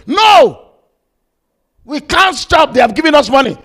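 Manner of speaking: high, loud protest; acted gunman shouting down his partner; forceful refusal. Gunman refuses to stop the job.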